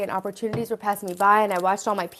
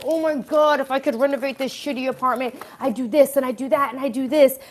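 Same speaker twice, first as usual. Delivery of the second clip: higher-pitched, imitating her excited daydreaming; eager past self; 5 semitones higher than usual. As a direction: act out pent-up renovation enthusiasm. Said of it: "so much potential energy in me".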